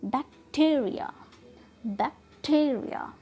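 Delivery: Bacteria with TEE stressed; syllable stress. Shown as a demonstration. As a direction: lean on 'टी' in bacteria, before the light ending. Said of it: "उस पर हम trust करके इसे ऐसे बोल रहे हैं". (बैक्टीरिया में 'टी' पर ज़ोर)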